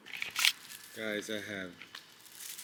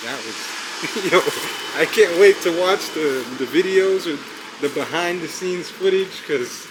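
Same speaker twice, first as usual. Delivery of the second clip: excited; 5 semitones higher, 12 dB louder than usual. Real, not acted. Hyped recap at end.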